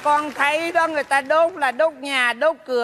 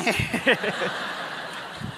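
soft, voiceless laughing fit; teasing laugh; 5 semitones lower, 7 dB quieter than usual. Acted. Neighbour joking about shared stomach trouble, wheezy amused laugh. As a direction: laugh softly, trailing away.

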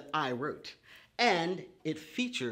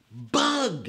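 bright, louder delighted answer; excited discovery; 3 semitones higher, 7 dB louder than usual. Acted. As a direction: announce the word like a happy surprise. Child lesson word discovery.

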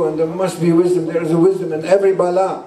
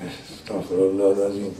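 low, soft murmur asking forgiveness; murmured prayer; 11 semitones lower, 7 dB quieter than usual. Real, not acted. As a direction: breathe the prayer quietly, much lower.

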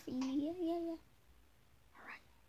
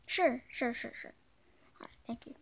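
ordinary delivery

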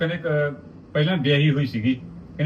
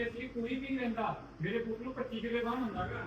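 much softer, higher mocking imitation; hushed mimic of the mother; 6 semitones higher, 15 dB quieter than usual. Repeating the boy's mother's boast, hushed and mocking. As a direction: hush down and mock her boast about ਵਾਹਣ. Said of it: "ਕਹਿੰਦੀ".

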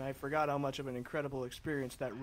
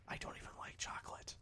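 breathy whispered aside; hushed closing whisper; 20 dB quieter than usual. Real, not acted. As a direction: whisper it like a secret confession. Whispered aside at video end.

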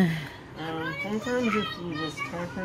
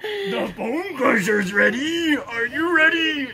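loud, higher wrestler hype voice; Macho Man Randy Savage; 9 semitones higher, 4 dB louder than usual. Acted. Challenging the partner as the Bone Crusher, loud wrestler impression. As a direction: roar it like a ringside challenge. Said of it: "is that Randy Savage?".

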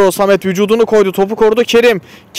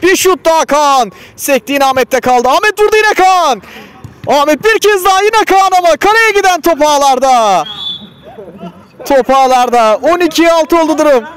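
excited commentator; 9 semitones higher than usual. Real, not acted. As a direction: build up through the scramble to the goal. Goal scramble, live commentary.

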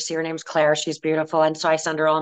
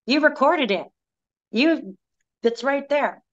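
bright reassurance; 7 semitones higher than usual. Retold or imitated. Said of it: "I'm like".

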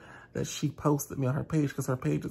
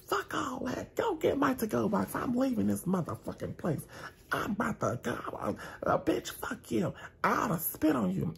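pitched way up, storming off in a huff; mocking angry customer; 7 semitones higher than usual. Customer cursing the waiter as she leaves, high mock rant. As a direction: imitate her screeching exit, high.

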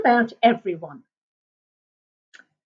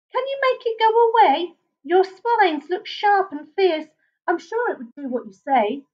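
put-on high pleading bush baby; 8 semitones higher than usual. Basil begging porcupine.

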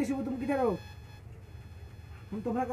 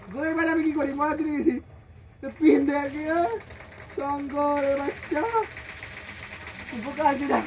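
frantic shouting; 6 semitones higher, 5 dB louder than usual. Real, not acted. Frantic during fight.

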